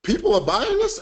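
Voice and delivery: high-pitched incredulous question, pitch shooting up; shocked disbelief. Retold or imitated. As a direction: re-enact your astonishment at the news. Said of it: "I was just shocked".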